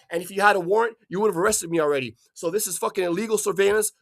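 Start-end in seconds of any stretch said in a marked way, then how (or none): none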